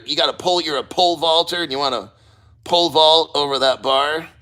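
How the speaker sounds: ordinary delivery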